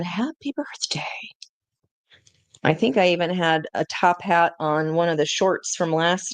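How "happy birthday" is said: soft unvoiced whisper as Frosty; Frosty the Snowman whispering a greeting to the viewer. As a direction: breathe it like a tiny whispering snowman. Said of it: "And he's saying".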